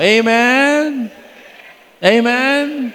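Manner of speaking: high, loud preacher's Amen; preacher urging the congregation to say amen; rousing call. Real, not acted. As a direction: call the crowd to answer. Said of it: "calling congregation for response".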